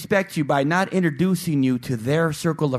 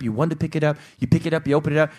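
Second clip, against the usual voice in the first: ordinary talk, nothing marked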